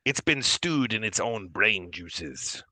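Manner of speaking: slow, savoring granny voice; granny Duergar woman. Acted. Granny Duergar tempts guest with her dish, slow granny voice. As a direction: relish it slowly as an old hostess. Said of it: "A granny Duergar woman".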